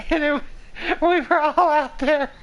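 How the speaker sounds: high voice breaking up with real laughter; laughing hard. Real, not acted. Storyteller cracking up at her campfire tale, laughing hard. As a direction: try to tell it through helpless laughter. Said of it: "I'm laughing so hard".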